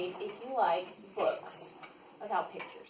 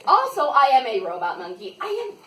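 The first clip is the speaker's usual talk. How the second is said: ordinary delivery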